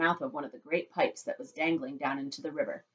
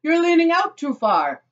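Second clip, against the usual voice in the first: high, loud drawn-out warning; alarmed warning shout; 12 semitones higher, 11 dB louder than usual. Acted. Father warns son leaning over river, alarmed shout.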